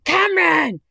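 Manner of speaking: playful shouted complaint to a dancing workmate; shouting across. Retold or imitated. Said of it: "And so I shout to Cameron".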